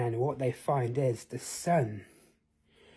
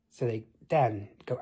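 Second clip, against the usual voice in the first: usual speaking voice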